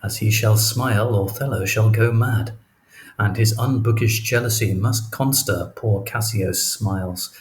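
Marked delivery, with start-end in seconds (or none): none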